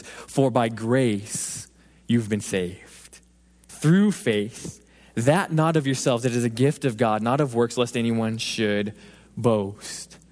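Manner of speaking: slow, hushed scripture reading; lingering on each phrase of the verse. Acted. Preacher reading Ephesians to the congregation, slow and hushed.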